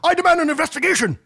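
high, fast theatrical demand; hammed-up indignant outburst; mock outrage. Mock outrage over falling shares.

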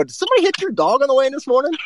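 high, quick mock-innocent jab; sarcastic poke asked high and fast. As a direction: needle him with a fake concerned question. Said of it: "I just flat said".

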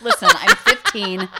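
high, loud, slow through laughter; laughing mock plea. Acts desperate teen through laughter.

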